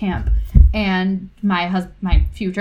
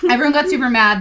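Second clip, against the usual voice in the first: ordinary talk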